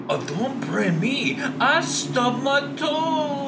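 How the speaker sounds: high, slow, pleading voice; whiny sketch character. Retold or imitated. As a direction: beg them not to hit you, high and slow.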